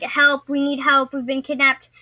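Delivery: ordinary talk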